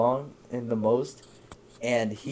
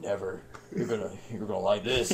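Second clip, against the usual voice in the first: mock menace; 5 semitones lower, 8 dB quieter than usual. Mock-menacing reply to begging friend.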